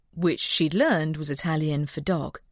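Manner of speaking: usual speaking voice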